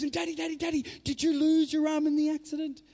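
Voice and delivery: put-on high, eager child's voice calling Daddy; little daughter's voice. Little girl to her injured dad in hospital, high eager child voice. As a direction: play a four-year-old girl rushing to her dad's bed. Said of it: "And she goes".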